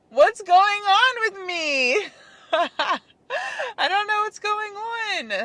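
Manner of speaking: high, loud, half laughing, half crying; a real, helpless laugh that tips into tears; exasperated laugh-cry. Real, not acted. Talking to viewers about her slump, laughing close to tears. Baffled by her own low mood.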